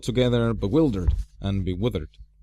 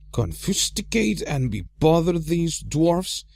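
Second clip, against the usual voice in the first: annoyed outburst; 8 semitones higher than usual. A narrator's reading. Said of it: "he said out loud".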